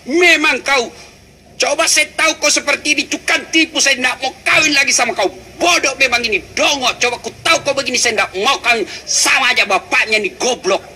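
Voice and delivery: high-pitched mock scolding wife; hammed-up angry wife hurling insults at her husband; wife berating her husband, high and hammed-up; cursing wife. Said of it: "Tapi kalau memaki, haram".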